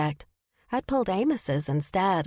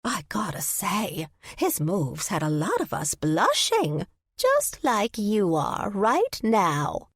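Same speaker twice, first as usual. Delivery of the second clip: slow, sly granny gossip; teasing old lady; 2 semitones higher, slower than usual. Acted. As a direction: tease slowly like a nosy granny.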